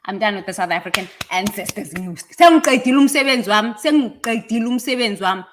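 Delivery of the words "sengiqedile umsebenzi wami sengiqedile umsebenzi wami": fast, raised, insistent Zulu declaration; loud declaration. Acted. Speaker declaring in Zulu that the work is finished, loud and fast. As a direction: rattle it off loudly, easing slightly.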